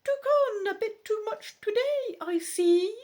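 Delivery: very high, loud squeaky owl. Acted. Barn owl to the struggling rowers, squeaky voice. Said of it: "he says in a squeaky voice".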